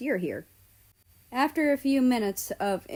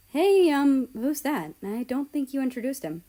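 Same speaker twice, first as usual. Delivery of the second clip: curious question; 6 semitones higher, 5 dB louder than usual. Acted. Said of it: "I said".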